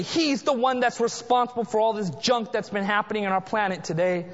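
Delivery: ordinary delivery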